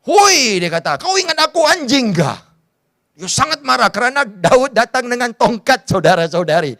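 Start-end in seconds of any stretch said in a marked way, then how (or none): 0.0-0.6 high, loud angry "Hoi"; angry shout. Acted. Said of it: "Dia terus marah". 1.0-2.4 high, indignant snarl; furious at the stick; angry. Acted. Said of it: "Dia terus marah".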